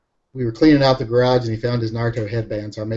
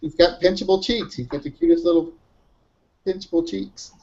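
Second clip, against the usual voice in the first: high cooing sing-song voice; cooing baby talk; 5 semitones higher than usual. Acted. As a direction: coo over a cute face. Cooing about son's cheeks.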